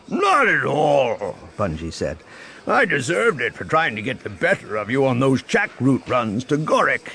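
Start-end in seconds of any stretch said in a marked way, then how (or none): 0.1-1.4 jovial boss voice. A narrator's reading. Bungie answers. 2.7-7.1 jovial boss voice. A narrator's reading. Bungie admits.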